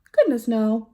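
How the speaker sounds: plain reading voice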